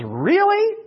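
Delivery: Jesus mocking Peter's boast with a skeptical "Really?"; incredulous really. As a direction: doubt him out loud with a soaring "Really?". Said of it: "you have to read between the lines to find the word "really".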